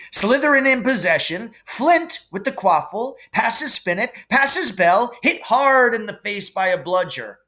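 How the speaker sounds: fast, high sports commentary; excited match commentator. A narrator's reading. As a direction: call the match like a live commentator.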